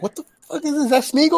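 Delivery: very high, freaked-out squeal; shocked. Retold or imitated. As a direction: shriek at a creepy monster. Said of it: "I was like".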